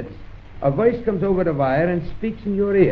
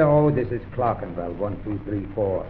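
low voice, dropping softer at the end; low-pitched exchange voice, fading away; voice on the line; 9 semitones lower, 4 dB quieter than usual. Acted. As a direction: answer low on the phone, tailing off. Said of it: "And then you hear".

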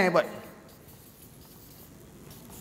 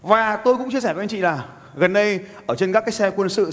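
ordinary talk

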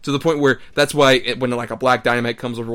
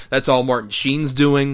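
ordinary talk

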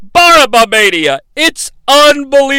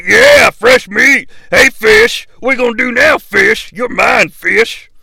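taunting prison guard. Retold or imitated. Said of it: "punishment guards start laughing like".

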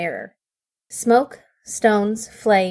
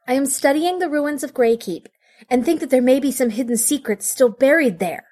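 put-on eager young voice; 6 semitones higher than usual. Apprentice explains her research.

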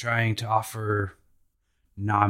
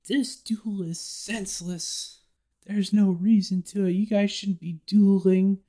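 much higher pleading call, then louder; Vivi calling out; 11 semitones higher, 5 dB louder than usual. Retold or imitated. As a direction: plead loudly to stop the duel. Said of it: "Vivi starts calling out".

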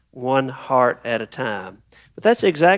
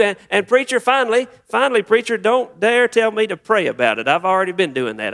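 exasperated venting; 5 semitones higher than usual. Acted. Parishioner warning the preacher off advising prayer, exasperated venting. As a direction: act out a fed-up churchgoer cutting the preacher off. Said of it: "begin to just dump everything out".

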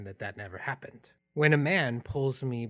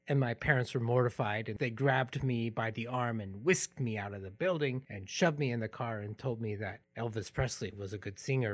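normal talking voice

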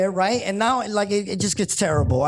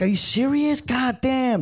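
exasperated disbelief; 5 semitones higher, slower than usual. Retold or imitated. Retold disbelief at cab advice.

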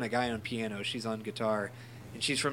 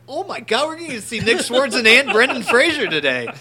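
much higher and louder, gushing mock fan; giddy fan excitement; 11 semitones higher, 10 dB louder than usual. Retelling to podcast friends the star sighting, high loud mock gushing. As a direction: squeal it like a starstruck kid.

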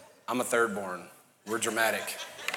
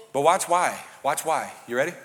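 ordinary delivery

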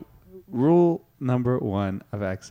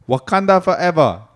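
loud, high exclamation in Wakandan accent; 6 semitones higher, 9 dB louder than usual. Shown as a demonstration. Improv teacher showing the class an accent's catchphrase, Wakandan accent. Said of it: "know the classic line for that accent".

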